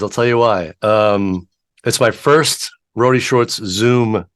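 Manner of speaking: ordinary talk, nothing marked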